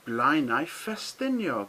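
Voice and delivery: "Blaenau Ffestiniog" slowly, ff as a plain f; slow Welsh demo. Shown as a demonstration. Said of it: "double F was an F sound".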